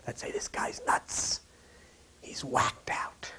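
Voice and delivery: whispered doubting inner voice; mock whisper. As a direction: hiss the doubts like a sneering inner voice. Left brain voice mocking sermon.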